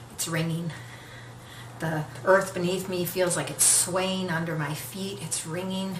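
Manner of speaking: low, very soft, tense narration; hushed tension. Retold or imitated. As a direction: hold your breath as it rings. Tense wait as phone rings.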